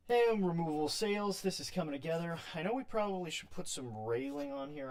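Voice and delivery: loud, high excited burst. Real, not acted. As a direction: show off the sails with glee.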